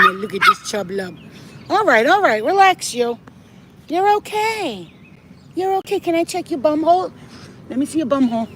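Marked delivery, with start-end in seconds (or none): 1.7-8.5 very high cooing reassurance; calm the squirming cat in high coos; gentle sing-song comfort. Real, not acted. Soothing nervous cat.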